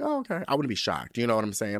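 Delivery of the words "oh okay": much higher, light and breezy; high shrug of an oh okay; unbothered. Retold or imitated. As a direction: toss it off up high. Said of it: "I'd be like".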